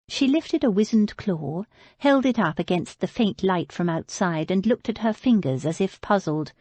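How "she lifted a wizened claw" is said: ordinary delivery, nothing marked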